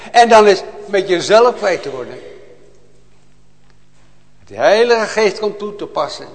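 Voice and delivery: loud, high, impassioned preaching; fiery preaching. Acted. Preacher to his congregation, a loud fervent cry. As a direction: proclaim it to the whole church with full fire.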